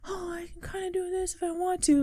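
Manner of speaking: soft, high, timid mumbler impression; Michael Cera. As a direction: shrink into a small, high, hesitant voice. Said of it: "Like my Michael Cera impression".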